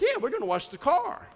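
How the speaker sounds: high-pitched little cheer; imitated fun excitement. Young daughter thinking car wash is fun, mock excitement. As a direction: imitate a child's eager anticipation. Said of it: "it was a fun thing to think".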